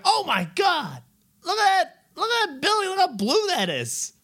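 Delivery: high, wide-eyed gushing at a sight; excited gasping. Retold or imitated. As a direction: gush like you just spotted something amazing. Gawking at a building in car.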